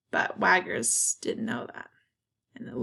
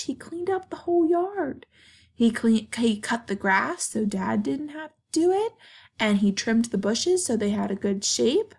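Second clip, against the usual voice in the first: ordinary delivery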